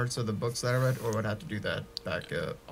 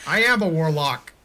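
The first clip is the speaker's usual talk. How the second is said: proud declaration; 4 semitones higher, 8 dB louder than usual. Real, not acted. Proud claim to be warlock.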